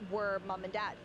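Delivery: normal talking voice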